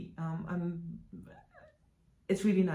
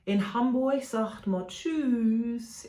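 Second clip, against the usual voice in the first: sign-off catchphrase; 5 semitones higher, 5 dB louder, much slower than usual. Shown as a demonstration. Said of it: "sign off as I usually do".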